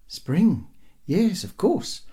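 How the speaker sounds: high, lifting voice; hopeful wife. A narrator's reading. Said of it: "her voice filling with hope".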